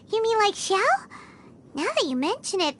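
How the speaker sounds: usual speaking voice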